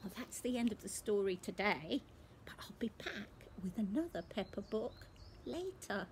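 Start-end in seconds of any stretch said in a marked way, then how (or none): 2.4-6.1 soft whispered aside; whispered promise. Real, not acted. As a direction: whisper it to the little ones. Reader signs off.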